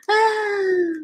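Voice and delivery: high, drawn-out mock-dreamy "ah"; imitated swoon. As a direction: sigh "ah" up high like a smitten fan. Imitates swooning at poetry read aloud.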